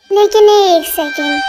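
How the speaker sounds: slow, tearful pause mid-thought; crying. Acted. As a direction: choke up on it slowly. Same line, now crying.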